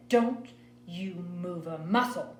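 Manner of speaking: slow, angry Southern mother; Southern accent. A narrator's reading. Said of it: "she started talking in that real Southern style accent".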